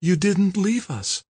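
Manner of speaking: slow, high woman's voice; repeated in amazement. A narrator's reading. Kim repeats it to her rescuer, slow and high. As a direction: draw it out slowly, pitched high. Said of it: "Kim repeated, her eyes riveted".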